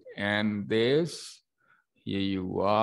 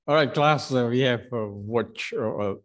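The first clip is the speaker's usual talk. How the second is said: calling out; 2 semitones higher, 6 dB louder than usual. Real, not acted. Teacher calling class to order.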